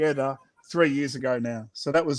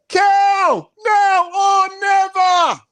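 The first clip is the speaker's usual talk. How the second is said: much higher, louder call; rally the cow to jump; rallying shout; 6 dB louder, much slower than usual. Acted. Group cheering the cow.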